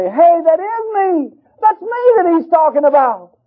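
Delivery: high, slow stunned recognition; dawning realisation. Retold or imitated. Preacher realizing God means him, high stunned. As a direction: let it dawn on you, voice lifting high.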